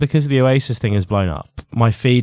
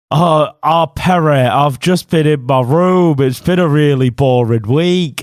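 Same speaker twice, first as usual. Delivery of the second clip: put-on slow, botched Manchester accent; bad Manc accent; 3 dB louder, slower than usual. [name] copying his friend Perry, bad Manc accent. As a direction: copy a Manc accent and fail to nail it. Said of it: "Perry turns up with a bad Manc accent".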